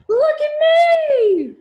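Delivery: very high, loud yell; shout. Acted. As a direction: shout it over everything. Brother shouts during video game.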